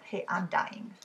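ordinary delivery, nothing marked

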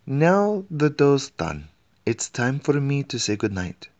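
cheery cook. A narrator's reading. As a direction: bustle it out as the palace cook. Cook voiced.

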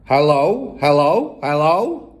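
loud calls, each one rising; loud, clear parrot. A narrator's reading. Hidden parrot calls across the hall, loud. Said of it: "a voice came loud and clear".

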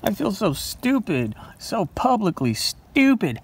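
slow high embarrassed whine; whiny embarrassment. Real, not acted. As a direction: whine about feeling dumb. Embarrassed after wrong answer.

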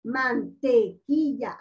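"mantequilla" slowly pulled apart, man-te-QUI-lla; syllable by syllable. Shown as a demonstration. Teacher showing where force falls, "mantequilla" slowly split by syllable. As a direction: say "mantequilla" in slow beats, marking "qui". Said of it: "mayor fuerza de voz".

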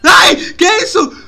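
loud, high scream of fright; shocked, shouting questions after a scare; startled. Real, not acted. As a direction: shriek at a sudden jump scare. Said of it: "Que susto!".

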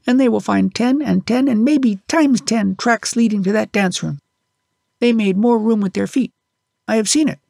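put-on high, eager little boy telling his tale; a small boy earnestly insisting he saw it; child pleading to be believed, voice pitched high; child's voice. A narrator's reading. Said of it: "as a child will".

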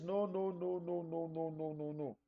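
Jesus quietly refusing, trailing away; calm refusal. Retold or imitated. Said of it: "Jesus says".

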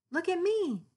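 high, loud proud cry; kitty showing off her puffed tail; excited kitty. Acted. Kitty shouts to her friends, high proud cry.

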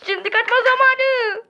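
high, loud laugh while speaking. Pretend. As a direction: laugh as you flee. Laughing, starting to run.

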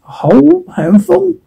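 can't believe such waste; surprised. Acted. Daughter amazed at her father's generosity with coal, surprised. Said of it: "Sis, surprise".

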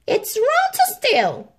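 high, slow, sniffy royal voice; snorting Queen. A narrator's reading. Queen scolding the cat, snorting. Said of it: "she snorted".

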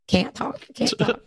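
voiceless whisper. Retold or imitated. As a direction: rasp it out with no voice. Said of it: "I was like".

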